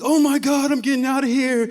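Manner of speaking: high-pitched imitation of a panicking date; hammed-up, frantic squeal of alarm; mock panic. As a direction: mimic someone bolting in fright. Date panics and flees, retold.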